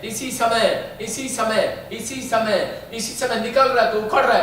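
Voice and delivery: forceful, insistent repeated commands; exorcism preaching. Real, not acted. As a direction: hammer each phrase at the spirit. Continues casting out the spirit. (दुष्ट आत्मा को ज़ोर से आदेश)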